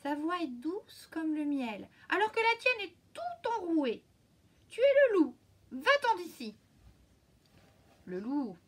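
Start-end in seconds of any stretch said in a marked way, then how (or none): 0.0-6.5 high, loud little goats building to a yell; kids shouting. A narrator's reading. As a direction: chase the wolf away, crying out. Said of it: "crièrent les chevreaux".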